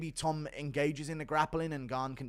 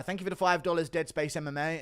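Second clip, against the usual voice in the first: ordinary delivery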